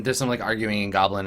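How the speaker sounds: ordinary delivery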